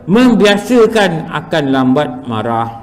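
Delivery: slow, measured reading, word by word; reading text aloud. Acted. Teacher reads the cure for anger to students, slow reading. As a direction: read the instruction out deliberately.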